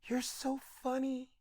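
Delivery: high, hushed, breathy-soft compliment. Retold or imitated. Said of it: "looks me dead in my face and goes".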